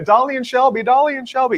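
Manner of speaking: high mock shriek of the name; imitating screaming fan. Mocking the screaming couple from the viral video, high shriek. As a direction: squeal it like a drunk screaming fan. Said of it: "Where they were like".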